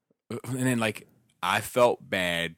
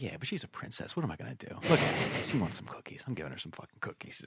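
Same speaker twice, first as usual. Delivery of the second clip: soft, hushed, mostly unvoiced indulgent voice; imitating Hung; 2 semitones higher, 7 dB quieter than usual. Friend explains spoiling the kid with cookies, hushed indulgent voice. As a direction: mutter it like a doting softie. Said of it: "And he's like".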